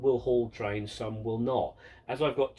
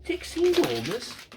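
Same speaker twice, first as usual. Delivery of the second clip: much higher, slow, disbelieving question; incredulous; 12 semitones higher, slower than usual. Real, not acted. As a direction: repeat the name as the penny drops. Realises the name is a joke.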